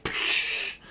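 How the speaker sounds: long airy burst noise; hissed "psh". Pretend. Singer adding a bust sound, airy psh. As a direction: let out a rush of air. Imitates noise amid song.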